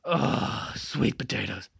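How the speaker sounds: usual speaking voice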